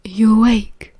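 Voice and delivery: hushed whispered question. A narrator's reading. Remus checks if Sirius is awake, whispering. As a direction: whisper it into the dark room. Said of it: "he whispered redundantly".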